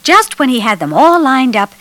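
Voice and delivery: plain reading voice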